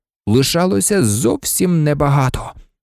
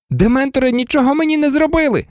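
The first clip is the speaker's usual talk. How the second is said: high, rapid, elated cry; excited exclamation; 9 semitones higher, faster than usual. A narrator's reading. Harry telling Professor Lupin about the Dementors, excitedly. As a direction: blurt it out high and fast. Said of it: "збуджено вигукнув Гаррі".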